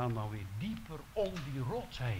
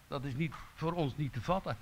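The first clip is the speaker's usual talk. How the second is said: ordinary talk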